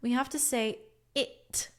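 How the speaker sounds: ordinary talk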